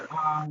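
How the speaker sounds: "a" held long on one level pitch; even, sung-out "a"; constant first tone. Shown as a demonstration. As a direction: sustain "a" without movement. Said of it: "du ton constant".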